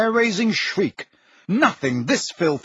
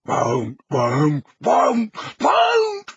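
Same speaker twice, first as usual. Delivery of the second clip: rising to a scream; much slower than usual. A narrator's reading. House elf screaming his refusal at Harry. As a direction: climb into a shrieking wail. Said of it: "voice had risen to a scream".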